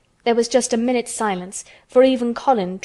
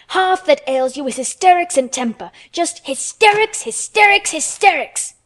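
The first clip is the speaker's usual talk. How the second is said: high, loud scolding tirade; angry shout; 8 semitones higher, 5 dB louder than usual. A narrator's reading. Furious girl scolds the boy, stamping with each shout. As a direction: pound each repeat like a foot stamp. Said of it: "shouted Mary".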